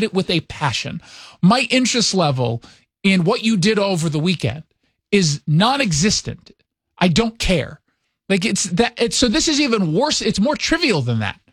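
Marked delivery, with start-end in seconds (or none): none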